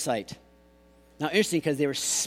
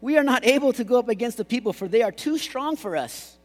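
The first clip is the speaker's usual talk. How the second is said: raised, louder mock whining; whiny voice; 6 semitones higher, 3 dB louder than usual. Preacher voicing the fearful spies, mock-whiny. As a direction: whine it out in a higher voice. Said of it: "that's my whiny voice".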